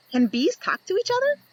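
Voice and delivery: ordinary delivery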